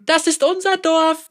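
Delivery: hoher, eifriger Einwurf; hilfsbereiter Dorfbewohner. Acted. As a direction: naiv dazwischenrufen. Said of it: "warf jemand hilfreich ein". (eifrig hilfsbereiter Dorfbewohner)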